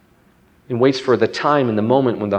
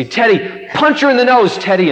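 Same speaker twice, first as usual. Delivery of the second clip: imitating kids goading a friend, high and loud; egging on; 9 semitones higher, 3 dB louder than usual. Kids turning to Teddy, excited mock goading. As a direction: urge him on like a playground crowd.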